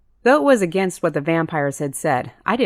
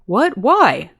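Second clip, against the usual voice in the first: louder, higher indignant outburst; sharp offended questions; 6 semitones higher, 5 dB louder than usual. A narrator's reading. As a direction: protest like you've been insulted. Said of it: "he asked indignantly".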